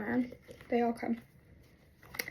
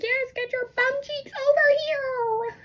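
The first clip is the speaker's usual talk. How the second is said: very high, drawn-out squeal; retold frantic demand; 14 semitones higher, slower than usual. Stuck girl summoning mom, high frantic squeal. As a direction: squeal the order, stretching it.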